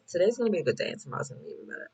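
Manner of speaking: quick upbeat rush; fast affirmation. Shown as a demonstration. Showing viewers how to speak the affirmation, fast. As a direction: rattle it off quickly and brightly. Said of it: "literally say that out loud".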